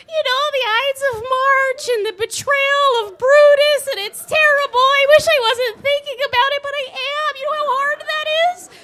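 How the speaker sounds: very high, loud fretful friend voice; distressed friend. Retold or imitated. Roommate Christine whining late at night, very high. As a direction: fret in a squeaky high voice over Caesar.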